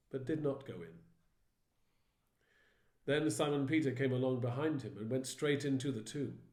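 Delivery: ordinary delivery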